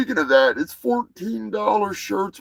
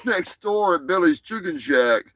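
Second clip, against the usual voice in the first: usual speaking voice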